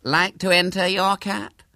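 high, loud, casual invitation; careless. A narrator's reading. Hubert invites William's scrawny cat to compete, careless. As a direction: toss out the challenge as if idle. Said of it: "repeated Hubert carelessly".

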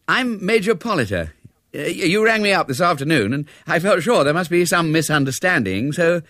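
high, hesitant, well-meaning officer; young Major, apologetic. A narrator's reading. Young soldier explains himself to Ethel, politely hesitant. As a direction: introduce yourself, then falter politely.

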